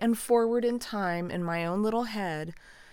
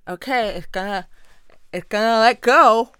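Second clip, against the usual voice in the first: loud, strained self-coaching through pain; strained self-talk; 5 semitones higher, 14 dB louder than usual. Retold or imitated. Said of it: "and just".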